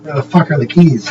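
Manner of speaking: ordinary talk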